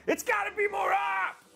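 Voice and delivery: sudden high-pitched comic shout; mock outburst. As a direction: yell it out in a squeaky, over-the-top burst. Sudden mock yell at the camera.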